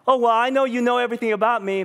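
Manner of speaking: high, fast mocking flippant voice; mock careless. Preacher mocking a careless believer, high and flippant. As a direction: toss it off like you don't care. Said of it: "you cannot say".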